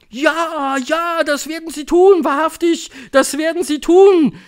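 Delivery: high, loud excited agreement; high-pitched crowd yell; screaming audience. A narrator's reading. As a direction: cry it out like a roused crowd. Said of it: "schrien die Zuhörerinnen".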